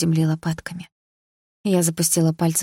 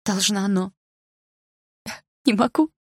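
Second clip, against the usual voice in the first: bitter smile; 4 semitones higher than usual. A narrator's reading. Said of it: "широко улыбнулась я".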